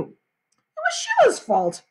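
high, drawn-out whine; protesting boy. A narrator's reading. Peter defending himself to his mother, high indignant whine. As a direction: whine the blame onto someone else.

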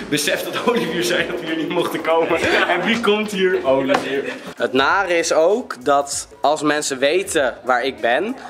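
usual speaking voice

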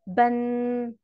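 "بن" on a level pitch, ending in a hummed n; audible final nun. Shown as a demonstration. Teacher has pupils listen for tanween, final n ringing in "بن". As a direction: hold the final n of "بن" like a ringing bell. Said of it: "أول ما أسمع جرس موسيقي".

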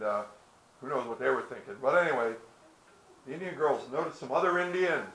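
plain reading voice

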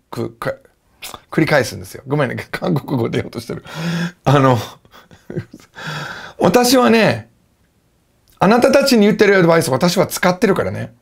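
usual speaking voice

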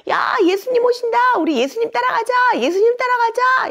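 high, loud shouts of excitement; rally everyone along at the top of your range; excited crowd. Acted. Storyteller voices villagers flocking to Jesus, excited high shouting.

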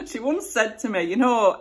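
normal talking voice

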